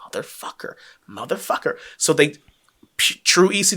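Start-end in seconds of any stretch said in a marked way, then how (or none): 0.1-1.8 muttered curse, much softer, half whispered; frustrated mutter. Retold or imitated. Hunter grumbles as Taz keeps getting up, muttered. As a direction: grumble it under your breath. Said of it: "Hunter's just like".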